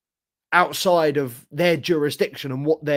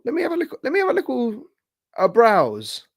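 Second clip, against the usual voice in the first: sing-song lift on each word, much higher; a goofy raised voice to himself; playful high voice; 8 semitones higher than usual. Pretend. Streamer hyping his new camera in a silly high voice. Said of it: "repeats line in high put-on voice".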